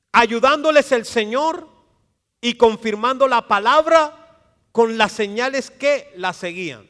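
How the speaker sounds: preacher lifting the congregation, loud and high; fiery preaching. Acted. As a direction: proclaim the verse with pulpit force. Preaching scripture at full force.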